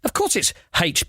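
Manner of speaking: "H" said as HAITCH; letter "H" with an h sound in front; haitch, imitated. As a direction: argue for "H" beginning like "hay". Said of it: "They say".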